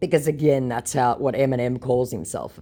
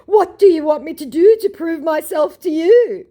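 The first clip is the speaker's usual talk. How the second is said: very high, loud whiny scammer; mock scammer voice; 8 dB louder than usual. Mocking scammer begging to prove himself, very high. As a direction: play him as a pleading fake. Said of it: "he said".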